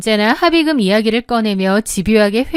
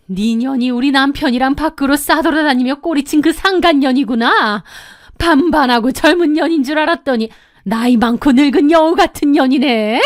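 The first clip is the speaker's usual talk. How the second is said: slightly raised, venomous accusation; a touch of seething rage; 3 semitones higher than usual. A narrator's reading. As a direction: lay into the mistress with contempt. Wife confronting the mistress. (남편 상간녀에게 분노하며 퍼붓는 본처)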